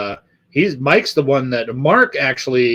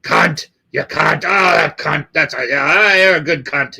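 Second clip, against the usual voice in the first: lightly imitating a Kiwi mate's New Zealand accent; 4 semitones higher than usual. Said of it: "the New Zealanders, they fucking, every other word".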